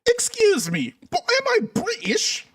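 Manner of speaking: put-on high-pitched comic British accent. Host teasing brothers across the pond, mock British accent. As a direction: put on a squeaky, exaggerated British voice. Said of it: "That's the best British accent I can come up with".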